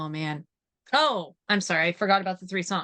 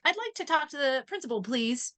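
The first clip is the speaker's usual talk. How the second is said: higher-pitched polite request; Kimmy Schmidt; 5 semitones higher than usual. Retold or imitated. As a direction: lightly imitate a sitcom heroine asking politely. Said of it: "she's like".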